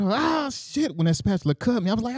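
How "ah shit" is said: high, pained mock yell; retold yell. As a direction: yelp it out without real volume. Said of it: "I just yelled".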